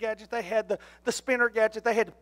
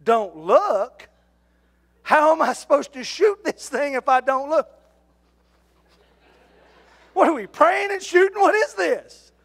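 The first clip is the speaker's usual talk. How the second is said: incredulous; 4 semitones higher, 6 dB louder than usual. Retold or imitated. Incredulous at being told.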